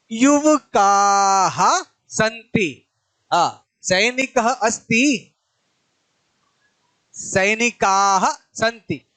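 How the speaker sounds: युवकाः and सैनिकाः with the final आः held long and slow; stressed endings. Shown as a demonstration. Teacher stressing word endings in a slow drill. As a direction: lean on the last syllable of युवकाः. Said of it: "आखिरी जो वर्ण है ना, उस पर विशेष ध्यान दो।".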